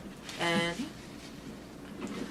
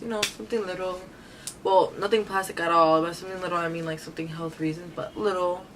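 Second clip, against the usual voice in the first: ordinary talk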